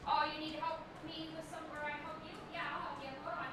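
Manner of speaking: distant, soft, off-mic talk; from another room. Pretend. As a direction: talk as if walking away from the mic. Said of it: "someone's calling me from the other room".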